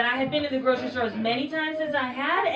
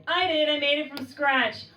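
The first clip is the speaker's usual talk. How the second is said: proud aunt, higher; 5 semitones higher than usual. Retold or imitated. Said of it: "they would say".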